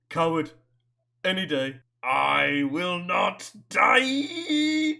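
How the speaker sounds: very slow, loud declaration; defiant hero line. Pretend. Fan echoing a hero's defiance, very slow and loud. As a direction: drag it out like a last stand.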